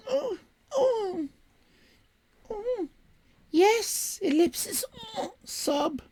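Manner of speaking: very high sobbing woman's voice; squawky female voice. Acted. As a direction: squawk and sob like a weepy lady. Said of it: "my generic squawky female voice".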